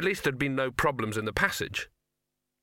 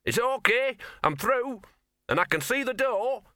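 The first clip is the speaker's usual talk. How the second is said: excited call; 11 semitones higher than usual. Acted. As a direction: call back excitedly from afar. Dog calls back from far side.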